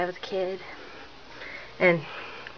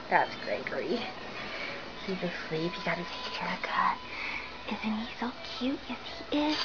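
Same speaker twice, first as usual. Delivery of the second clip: whispering over a crib; 11 dB quieter than usual. Real, not acted. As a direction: whisper so he stays asleep. Toddler asleep in room.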